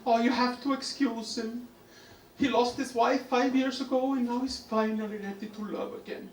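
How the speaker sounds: put-on high-pitched old lady confiding; high, gentle elderly-woman impression. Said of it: "the old woman comes up to me and she says".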